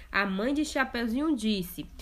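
usual speaking voice

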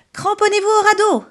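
high, loud warning shout; urgent call. Acted. Geppetto warns the group on the raft, loud shout. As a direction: yell to hold on tight. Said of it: "lance Geppetto".

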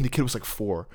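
ordinary talk

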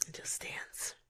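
soft whisper. Real, not acted. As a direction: breathe it out dreamily. Dreamy advice.

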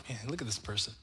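low, muttered critical aside; quietly mocking a critic's scorn; judgmental churchgoer. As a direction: sneer at the worshipper under your breath. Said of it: "and they're like".

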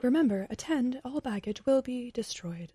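feminized announcer voice. Retold or imitated. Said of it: "incredibly feminized sort of voice".

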